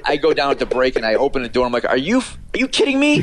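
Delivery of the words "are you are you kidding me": high-pitched outraged retort; furious. Retold or imitated. Retelling how he confronted Chet after the broadcast, furious. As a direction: explode in disbelief, voice shooting way up. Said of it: "I get up and I'm furious".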